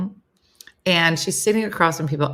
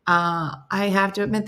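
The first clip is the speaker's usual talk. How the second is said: usual speaking voice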